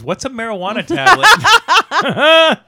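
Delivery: put-on high, loud, slow baffled kid voice, laughing through it; clueless kid. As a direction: play the puzzled kid while cracking up. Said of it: "And one of the kid goes".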